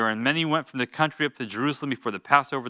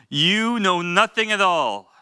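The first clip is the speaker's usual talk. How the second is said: slow, higher, louder scorn; scornful high priest; 5 semitones higher, 4 dB louder, slower than usual. Retold or imitated. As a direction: talk down to the council slowly. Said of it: "Caiaphas said to them".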